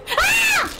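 short startled high shriek; sudden shriek. Real, not acted. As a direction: yelp in real fright. Jump scare in game.

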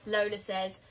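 usual speaking voice

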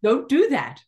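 the trees chiding with a laugh; voicing the trees scolding me. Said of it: "they said".

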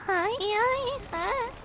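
high cartoon greeting; squeaky hi. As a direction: chirp a goofy greeting.

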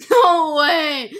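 loud, high disbelief; shocked. Real, not acted. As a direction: burst out in shock.

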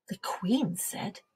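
ordinary delivery, nothing marked

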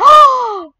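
loud, very high gasp; sharp, thrilled "oh"; shocked gasp. Real, not acted. Favourite pet appears.

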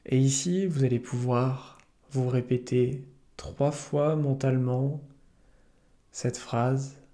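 calm yoga nidra guide, unhurried with long pauses; soothing meditation voice; guided meditation. Acted. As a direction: lead listeners gently into relaxation, leaving space between phrases.

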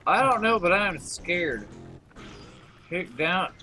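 ordinary talk

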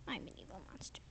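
low, hushed whispered confession. Real, not acted. Big sister whispering to the scared toddler that she's a monster.

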